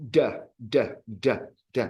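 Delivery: hard "d" repeated, stop sound; isolated d sounds. Shown as a demonstration. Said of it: "rather than".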